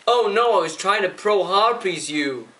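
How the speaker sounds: high, loud imitation of the opponent's dismay; hammed-up whine of the other player caught out; mock dismayed gamer voice; mock opponent panic. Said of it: "He goes".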